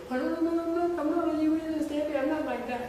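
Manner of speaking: high, loud mock-pleading voice; protesting student.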